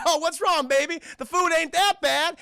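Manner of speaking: high, loud imitated ribbing; jokey crewmate kidding a coughing friend; teasing. Parker kids Kane as he coughs over dinner, imitated teasing.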